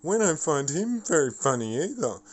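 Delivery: mock them as stuck-up and offended; mocking sniffy friends. Mocking upset friends who find him unfunny, high and snooty. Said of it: "It's like".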